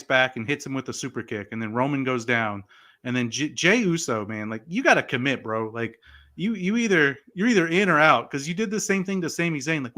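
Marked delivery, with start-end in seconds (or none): none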